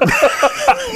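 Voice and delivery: high-pitched burst of laughter. Real, not acted. Amused at the silverware confession, a high laugh.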